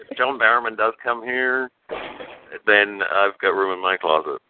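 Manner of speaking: ordinary talk, nothing marked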